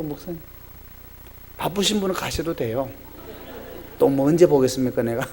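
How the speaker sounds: ordinary talk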